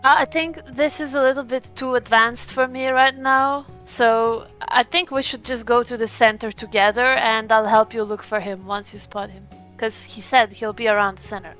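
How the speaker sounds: ordinary talk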